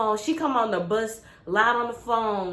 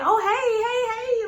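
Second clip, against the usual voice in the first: bright squealing hey hey, pitched way up; excited high greeting; 13 semitones higher, slower than usual. Retold or imitated. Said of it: "I'm like".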